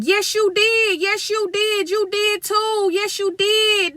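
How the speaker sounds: put-on high childish chant of yes you did; little girls accusing. Little sisters accusing their brother, high childish voice. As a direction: squeak it out like a little girl.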